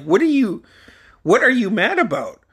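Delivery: high, incredulous reenacted question; baffled questioning. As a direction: act out bewilderment at being blamed. Confused reply to angry coach.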